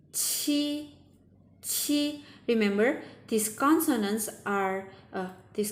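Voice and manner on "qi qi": "chi" as the chee of cheese; high, level "chi" with an aspirated ch; start of cheese. Shown as a demonstration. Teacher modelling from cheese, aspirated "chi". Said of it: "same with the beginning sound of cheese".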